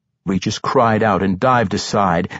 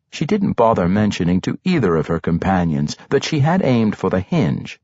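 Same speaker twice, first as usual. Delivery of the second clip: usual speaking voice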